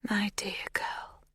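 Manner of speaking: breathy hushed whisper, slow; soft whisper. A narrator's reading. Magda whispering softly to the confused girl. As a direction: lean in and whisper it gently. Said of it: "she says softly".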